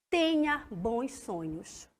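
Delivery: soft, slow goodnight; soothing bedtime wish; tender wish. Shown as a demonstration. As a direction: wish them sweet dreams gently. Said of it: "Deseja algo a alguém".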